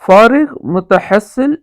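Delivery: فارغ متحصل drawn out slowly; slow, stressed term. Shown as a demonstration. Teacher to viewers, introducing the word slowly. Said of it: "اس کو بولتے ہیں".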